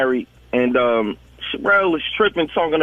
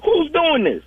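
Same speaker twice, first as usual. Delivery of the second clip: incredulous; 12 semitones higher than usual. Retold or imitated. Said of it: "I'm like".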